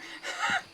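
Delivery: airy startled laugh; breathy high laugh. Real, not acted. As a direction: let a shocked laugh out. Laughing after the scare.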